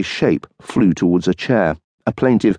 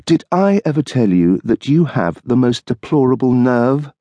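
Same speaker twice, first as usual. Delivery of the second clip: higher, wife parroting him tartly; mocking echo; 6 semitones higher than usual. Wife mimicking husband's flattery, tart mockery. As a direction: throw his words back at him.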